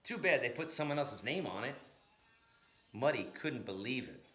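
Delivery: ordinary delivery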